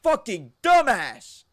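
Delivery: high, loud, slow disgusted shout; bunkmate's yell. Retold or imitated. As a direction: bunkmate yelling at the broken collarbone. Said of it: "And he goes".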